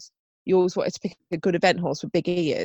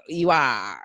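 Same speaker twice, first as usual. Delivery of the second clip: put-on pirate accent; 8 semitones lower than usual. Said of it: "'cause they say".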